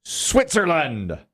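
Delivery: high, long-drawn-out announcer call; game-show reveal call. Real, not acted. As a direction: stretch each word to build suspense. Host calls for the board reveal.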